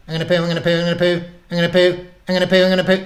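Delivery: high, loud frantic repetition; little creature in urgent, squealing alarm; panicked guinea pig. A narrator's reading. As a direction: repeat it in rising hysteria.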